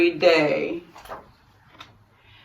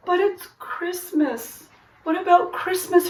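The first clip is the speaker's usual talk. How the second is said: high, soft little brother voice; a small boy puzzled and pleading; 10 semitones higher, 4 dB quieter than usual. A narrator's reading.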